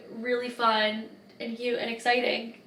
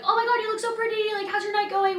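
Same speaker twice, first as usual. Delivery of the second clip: put-on very high, sugary girly gush; gushing bathroom girl; 11 semitones higher than usual. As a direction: imitate a friendly girl in the bathroom. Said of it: "they're like".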